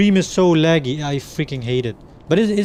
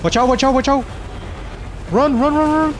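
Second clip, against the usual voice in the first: being chased; 8 semitones higher than usual. Real, not acted. Fleeing boss laser attack.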